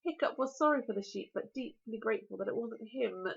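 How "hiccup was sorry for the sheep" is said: ordinary delivery, nothing marked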